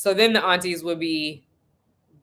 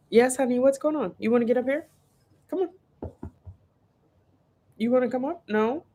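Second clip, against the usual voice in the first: higher, sweet coaxing voice; 7 semitones higher, faster than usual. Real, not acted. Mom coaxing her little girl to come on camera, sweet. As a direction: gently invite her up.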